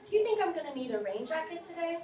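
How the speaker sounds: teacher showing a question; question intonation. Shown as a demonstration. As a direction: let the voice go up at the end. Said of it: "your voice kind of goes up at the end".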